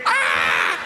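hammed-up jeering yell; low mock shout. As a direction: bark at the crying driver. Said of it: "people are gonna be like".

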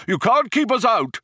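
high-pitched, protesting shout; defiant shout. A narrator's reading. Older man pushes past the programmer, defiant shout. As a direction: shout down whoever bars the way. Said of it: "shouted the older one".

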